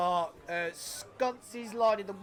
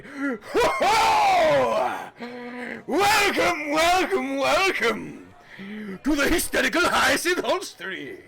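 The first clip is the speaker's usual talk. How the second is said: loud, hearty innkeeper welcome, higher and louder; booming barkeep; 4 semitones higher, 6 dB louder, slower than usual. Acted. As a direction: bellow a jolly welcome across the bar. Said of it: "you can kinda hear him going".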